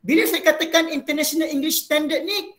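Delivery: ordinary talk, nothing marked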